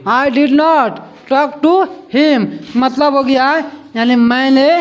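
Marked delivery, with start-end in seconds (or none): 0.1-2.5 very slow, word by word; slow dictation. Shown as a demonstration. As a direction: read it out slowly for copying. (धीरे-धीरे लिखवाना)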